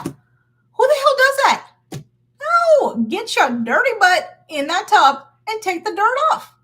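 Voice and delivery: high, loud scolding mother voice; loud high mom ordering a kid into the tub; exasperated mom. Pretend. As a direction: scold like a fed-up mom at bath time. Mock exasperated parent to child.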